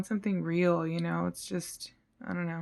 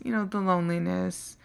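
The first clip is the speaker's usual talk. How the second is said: ordinary talk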